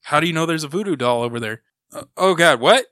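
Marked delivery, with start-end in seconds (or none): none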